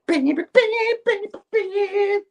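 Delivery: very high sung bing sound effect; magic sound effect. Retold or imitated. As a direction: make cartoon magic zaps. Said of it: "It's like".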